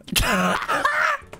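loud, high-pitched laugh; burst of laughter. Real, not acted. Laughs after his dare.

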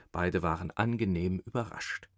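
usual speaking voice

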